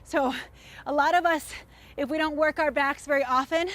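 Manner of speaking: ordinary talk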